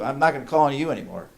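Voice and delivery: ordinary talk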